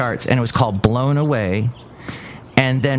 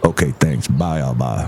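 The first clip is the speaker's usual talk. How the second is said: very low put-on character voice; visiting comic character; 12 semitones lower than usual. Visitor greeting the host, very low character voice. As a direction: drop right down for the odd visitor. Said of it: "Look who else is here".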